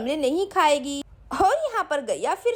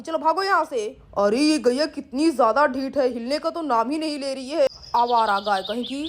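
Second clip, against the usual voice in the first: lower, grumbling man shooing a cow; grumpy man; 5 semitones lower than usual. Acted. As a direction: growl the annoyance low. Siddharth chases the cow.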